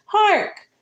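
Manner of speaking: a startled father's cry of hark; old-fashioned alarm, called out high; exclaimed hark. Acted. Papa Blériot exclaims.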